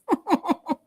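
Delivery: real high-pitched giggle; short burst of amused giggles; laugh. Real, not acted. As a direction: chuckle at your own joke. Laughs at her own joke.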